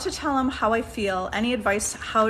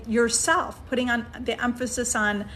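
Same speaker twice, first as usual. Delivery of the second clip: usual speaking voice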